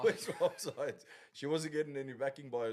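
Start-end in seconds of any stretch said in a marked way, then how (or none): 0.0-1.0 slow, laughing delivery; amused and drawn out. Real, not acted. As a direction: say it through a louder laugh. Laughs at own family story.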